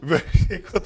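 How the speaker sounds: chuckling through the words; laughing. Real, not acted. Laugh heard while answering.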